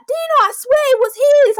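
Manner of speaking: very high, loud imitation of little brother. Brother swearing to his sister about the bike, high-pitched mimicry. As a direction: squeal the protest like a kid brother. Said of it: "High voice".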